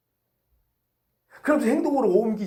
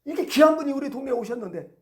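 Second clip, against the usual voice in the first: mock rebuke, pitched up; acted bystander's raised, reproachful voice; scolding; 6 semitones higher, 3 dB louder than usual. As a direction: tell off a noisy beggar indignantly. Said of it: "꾸짖는 거예요".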